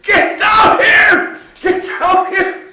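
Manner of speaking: loud desperate yell down the hill; shouting for help. Retold or imitated. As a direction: yell for your buddy to come down. Retold call to friend for help.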